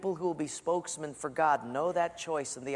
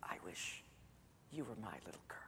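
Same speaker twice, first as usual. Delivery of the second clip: unvoiced whisper, very soft and low; teacher repeating it in a whisper; breathy whisper; 8 semitones lower, 20 dB quieter than usual. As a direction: barely breathe the words. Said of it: "she repeated it again".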